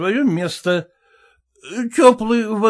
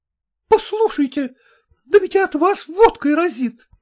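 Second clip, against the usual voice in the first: high-pitched indignant woman; indignant cry; 8 semitones higher than usual. A narrator's reading. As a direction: cry out in outrage at the medic, voice raised in pitch. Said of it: "крикнула фельдшеру Камышова".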